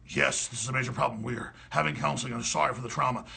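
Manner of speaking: mock dean. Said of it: "the dean comes out and says".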